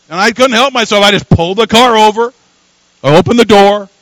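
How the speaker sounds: rising sermon excitement; excited preaching. Real, not acted. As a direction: build the thrill of giving in. Excited retelling of giving in.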